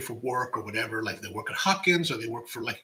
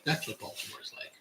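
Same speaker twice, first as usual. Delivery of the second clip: whispered aside; 11 dB quieter than usual. Real, not acted. Repeated as a soft aside.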